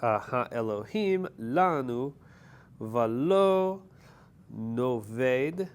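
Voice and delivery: Yitashet ha-Elohim lanu said slowly and clearly; slow Hebrew pronunciation. Shown as a demonstration. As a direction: spell out each Hebrew word slowly. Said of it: "Can you do the pronunciation on that line again?".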